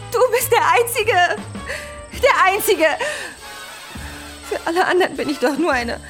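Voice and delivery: high, emotional outpouring; cling to your one confidant; distraught pleading. Acted. Distraught plea to absent listener.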